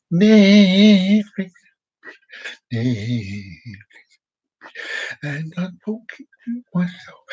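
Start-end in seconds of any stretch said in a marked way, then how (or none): 5.2-7.2 hushed whisper. Real, not acted. Vlogger realises he is whispering to the camera. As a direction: say it under your breath. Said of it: "Why am I whispering?".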